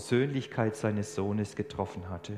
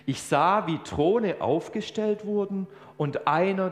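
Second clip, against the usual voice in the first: slow, awed reading sinking to a whisper at the end; solemn scripture reading; 6 semitones higher, slower than usual. Real, not acted. Preacher reading Daniel to the church, slow awed reading. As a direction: read the vision with reverence.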